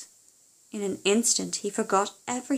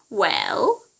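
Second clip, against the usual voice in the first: witch demanding; 6 dB louder than usual. Acted.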